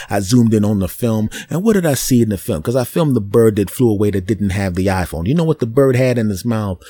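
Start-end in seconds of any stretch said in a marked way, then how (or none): none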